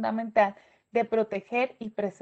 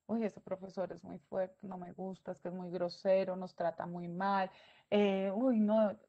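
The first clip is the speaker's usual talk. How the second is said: unhappy student; 3 semitones lower, 7 dB quieter than usual. Retold or imitated. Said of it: "piense la clase que no le gusta".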